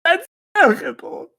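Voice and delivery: over-the-top fake weeping, voice cracking high; hammed-up sobbing. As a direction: wail it like an overacted crybaby. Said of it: "I start crying".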